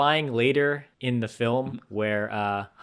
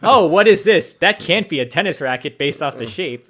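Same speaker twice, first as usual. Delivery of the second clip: loud, high opening of feigned puzzlement; mock surprise; 5 semitones higher, 7 dB louder than usual. Joking about badly wrapped Christmas presents, mock surprise. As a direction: pretend you can't guess the present.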